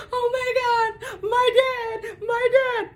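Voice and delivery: high, slow, tearful panic; crying out for her dad; panicked. Retold or imitated. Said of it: "I'm like".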